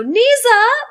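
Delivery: very high, loud mock cheer; high yelled exercise call; imitated class shout. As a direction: shout it back like a keen step class. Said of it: "they're shouting, like, the move".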